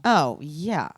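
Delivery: ordinary delivery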